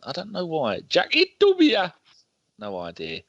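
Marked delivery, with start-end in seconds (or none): 0.9-1.9 high, slow Geordie accent on the name. Shown as a demonstration. Player's name in a mock Geordie accent. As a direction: sing the name out Geordie-style. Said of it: "I always get the urge to do that in a, a Geordie accent".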